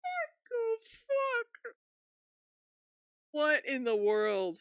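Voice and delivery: high, slow, half-laughing disbelief; stunned disbelief. Real, not acted. Streamer reacting to clip, stunned half-laughing disbelief. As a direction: drag it out, almost crying from shock.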